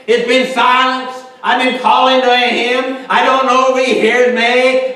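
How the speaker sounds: loud, high preacher's cry; fervent preaching. Acted. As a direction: preach it out at full force. Preaching at full force.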